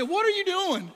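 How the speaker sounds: high, loud retold shout of alarm; startled scream. Crew leader to a three-year-old on the toilet, startled scream. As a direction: holler at the kid in comic panic. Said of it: "I started scr".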